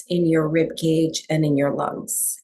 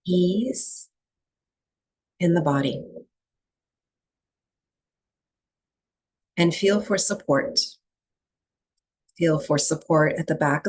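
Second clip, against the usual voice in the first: calm, even meditation voice with long pauses; guided breathwork meditation; 2 semitones lower than usual. Real, not acted. Teacher settling a group into breathwork, calm meditation guiding. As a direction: guide them into stillness, leaving long silences between phrases.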